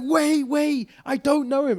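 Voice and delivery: high, loud, slow pleading woman's voice; pleading lady. Retold or imitated. Imitating the drunk lady begging them to wait, high and loud. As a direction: play the desperate drunk lady, high and drawn out.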